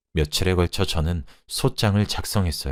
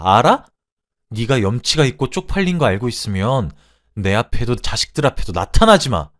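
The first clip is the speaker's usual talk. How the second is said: raised, cutting parting shot; snapping angrily; 5 semitones higher, 5 dB louder than usual. Acted. Wife warns cheating husband never to return, angry snapping. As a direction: lash out with finality, louder on key words. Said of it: "라고 쏘아붙이고".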